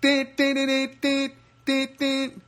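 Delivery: high, rhythmic car-horn beeps; mimicked horn pattern; imitated car honking. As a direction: beep out a car horn on one high note. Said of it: "I start honking the horn".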